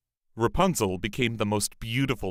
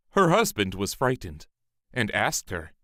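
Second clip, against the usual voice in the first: plain reading voice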